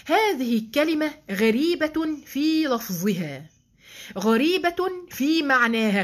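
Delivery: slow, weighty reading of the quote; slow emphatic quoting. Acted. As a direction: lean on each word like a scholar. Reciting al-Rafi'i quote slowly. (قراءة بطيئة مؤكدة للاقتباس)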